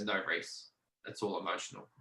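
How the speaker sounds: soft, low-key doctor's reply; quiet correction. Retold or imitated. Doctor corrects her patient in retelling, quietly firm. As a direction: gently set him straight in a hushed voice.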